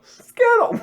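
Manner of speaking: high, loud amused echo; delighted squeal of Skittles; surprised squeal. Real, not acted. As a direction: squeak the word back in disbelief. Echoing friend in surprise.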